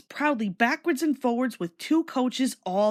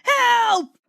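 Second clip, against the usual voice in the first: high, loud desperate whistling cry; panicked cry for help; 12 semitones higher, 7 dB louder than usual. A narrator's reading. Runaway engine James screaming for help to anyone. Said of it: "still whistling".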